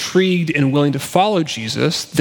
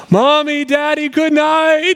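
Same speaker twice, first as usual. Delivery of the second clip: put-on high, drawn-out child's call; high singsong holler of a kid at bedtime; wailing child; 13 semitones higher, 4 dB louder, slower than usual. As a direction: imitate a little girl wailing across the house. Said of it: "we'll hear wailing across the span".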